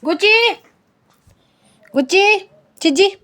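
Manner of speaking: high, loud sing-song call to the dog; high loud pet-name calling. Real, not acted.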